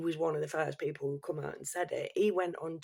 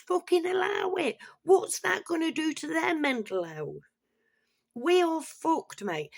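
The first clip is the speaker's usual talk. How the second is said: rising outrage; 9 semitones higher, 4 dB louder than usual. Real, not acted. Escalating rant about schools.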